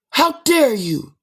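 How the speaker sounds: high, hammed-up gasp of offense; mock shocked outrage. Acquaintances shocked he did what he said, high mock outrage. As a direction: clutch pearls in exaggerated high disbelief. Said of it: "they be shocked".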